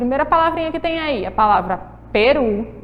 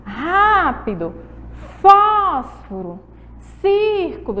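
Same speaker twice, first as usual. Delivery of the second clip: rápido, fósforo, círculo, each first syllable stretched; stressed antepenultimate syllables; 5 semitones higher, much slower than usual. Shown as a demonstration. Teacher counts syllables from the back, stretched first syllable of rápido, fósforo, círculo. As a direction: slowly hit "Rá", "fós", "cír" in rápido, fósforo, círculo. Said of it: "ela é a antepenúltima".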